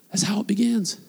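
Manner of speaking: a breathy unvoiced whisper, almost secret. Real, not acted. Pastor whispering the key point to the congregation, hushed. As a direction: lean in and whisper the point home. Intimate close of a sermon point.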